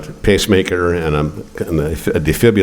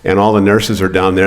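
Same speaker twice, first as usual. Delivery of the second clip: usual speaking voice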